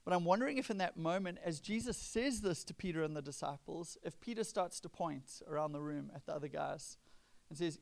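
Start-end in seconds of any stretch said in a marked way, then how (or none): none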